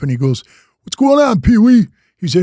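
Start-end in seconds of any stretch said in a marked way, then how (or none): 0.8-1.9 loud, high-pitched imitated hello; cordial phone greeting. Retelling Keith's greeting on the phone, loud and cordial. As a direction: greet an old buddy heartily, acting him out. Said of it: "he was real cordial on the phone".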